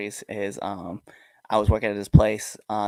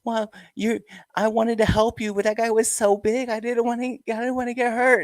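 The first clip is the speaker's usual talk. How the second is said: put-on much higher small child voice, shy and apologetic; little girl voice; 13 semitones higher than usual. Little girl tells the wrestler why she didn't help, high child voice. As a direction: play the scared little girl explaining herself. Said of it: "And she's like".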